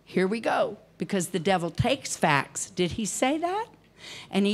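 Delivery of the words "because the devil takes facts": ordinary delivery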